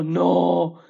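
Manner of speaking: no with a long held o; drawn-out pronunciation. Shown as a demonstration. Teacher repeats nine for learners, long drawn-out no. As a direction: stretch the vowel of no steadily. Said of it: "It's exactly like that".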